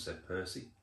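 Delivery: plain reading voice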